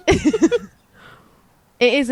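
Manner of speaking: slow words through laughter; laughing. Real, not acted. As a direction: laugh as you talk. Laughing while starting her point.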